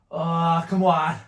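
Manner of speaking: loud effortful push; straining shout. Real, not acted. Straining mid-exercise, loud.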